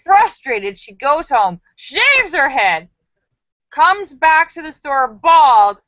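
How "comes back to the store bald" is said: ordinary talk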